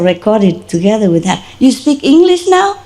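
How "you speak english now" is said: high, rising amazed question; astonished. Retold or imitated. Owner amazed dog speaks English, astonished. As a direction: ask the dog in high-pitched disbelief.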